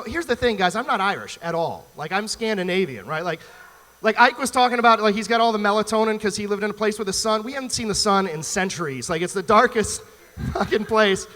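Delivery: ordinary talk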